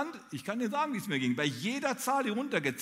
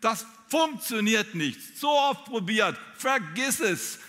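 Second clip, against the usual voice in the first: higher, louder, slow, discouraging; nagging inner voice; 6 semitones higher, 5 dB louder, slower than usual. Retold or imitated. The preacher voices the inner voice that shouts failure into your ear, slow and loud. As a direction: lay the doubts on slowly and loudly. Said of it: "die brüllt dir ins Ohr".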